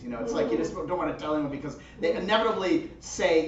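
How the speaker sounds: usual speaking voice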